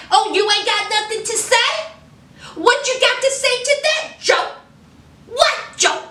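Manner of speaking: high-pitched, challenging mock interrogation; confrontational. Host as Nicki confronting Joe over the recording, indignant.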